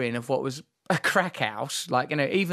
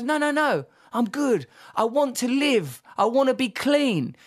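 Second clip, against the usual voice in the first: higher, louder earnest friend; earnest insistence; 8 semitones higher, 4 dB louder than usual. Retold or imitated. Friend insisting to Ross he wants to live, higher and louder. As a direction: insist you want to live.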